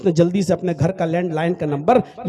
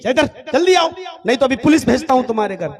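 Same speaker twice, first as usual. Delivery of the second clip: louder, faster, barking a threat of police; threatening shout; 3 semitones higher, 4 dB louder, faster than usual. Acted. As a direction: yell the ultimatum down the phone. Doctor threatening on the phone. (धमकी भरी चीख)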